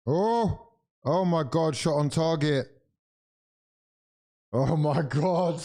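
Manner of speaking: high-pitched gasped-out excitement at the action; shocked outcry. Real, not acted. Fan watching a shot on target, shocked outcry. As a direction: cry out in real shock, pitch leaping up.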